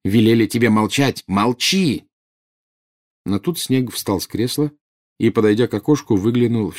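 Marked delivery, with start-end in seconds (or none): 0.0-2.0 emphatic, gloating command; triumphant. A narrator's reading. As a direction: press the victory home to your sister. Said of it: "с торжеством сказала она сестре". (торжествующе, повелительно)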